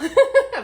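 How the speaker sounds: quick high laugh, loud; a short laugh up high. Real, not acted. Vlogger laughing at her own French accent, quick laugh.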